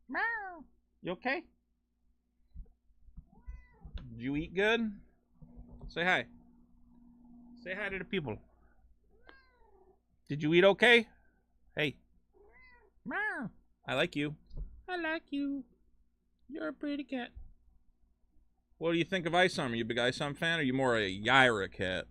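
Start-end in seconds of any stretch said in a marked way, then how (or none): 0.1-0.6 much higher, soft meow; mock meow. Streamer meowing back at his cat, high and soft. 1.0-12.0 cooing to cat. Real, not acted. Streamer cooing softly to his hiding cat. 13.0-13.5 much higher, soft meow; mock meow. Streamer meowing back at his cat, high and soft. 13.9-17.3 cooing to cat. Real, not acted. Streamer cooing softly to his hiding cat.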